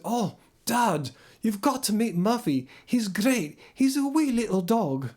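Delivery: put-on excited Scottish child telling Dad, in Glaswegian; Glaswegian accent. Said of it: "I'm doing a more Glaswegian accent".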